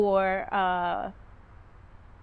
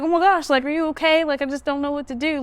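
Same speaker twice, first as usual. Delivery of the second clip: higher, hurried concern; acted worried alarm; 5 semitones higher, 3 dB louder, faster than usual. Asking a crying customer if he is okay, worried and high. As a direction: pitch it up, fretting over him.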